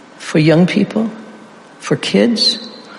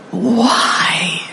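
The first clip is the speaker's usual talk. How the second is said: anguished why. Acted. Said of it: "what's the next word?".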